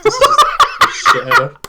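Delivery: high, loud laughing squeal. Real, not acted. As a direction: crack up mid-sentence. Breaking up laughing.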